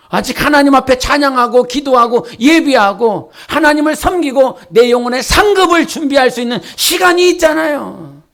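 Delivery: ordinary talk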